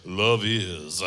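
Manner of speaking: deep, low radio DJ voice. Shown as a demonstration. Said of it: "drop your voice and say it in your best D J voice".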